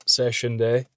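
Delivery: lisping with Invisalign. Real, not acted. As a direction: lisp through clear aligners. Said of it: "Excuse the lisping".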